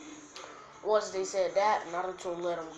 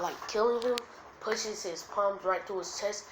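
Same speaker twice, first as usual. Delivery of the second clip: usual speaking voice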